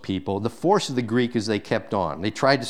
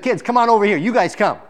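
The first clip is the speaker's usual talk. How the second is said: put-on higher and louder welcoming call to kids; warm eager call; 9 semitones higher, 4 dB louder, faster than usual. Jesus calling the children over, in an eager, raised call. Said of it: "Jesus said".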